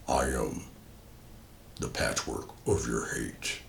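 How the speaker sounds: very low, slow, rasping demon voice; monster voice. Acted. The Bawaga speaking to Drummond, deep slow demonic rasp. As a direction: growl it from deep below, slow and whispered. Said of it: "he actually says a few words".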